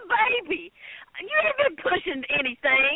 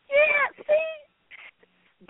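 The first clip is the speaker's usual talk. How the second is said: mocking squeal; 13 semitones higher than usual. Real, not acted. Mocking Bob.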